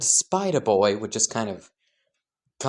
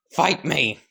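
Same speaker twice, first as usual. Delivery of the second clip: eager challenge; 5 semitones higher than usual. Acted. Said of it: "stop yelling at Doma".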